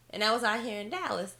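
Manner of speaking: usual speaking voice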